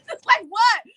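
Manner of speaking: high, loud disbelief through a laugh; incredulous. Retold or imitated. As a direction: react as if you can't believe the nerve. Incredulous reaction to fans' demands.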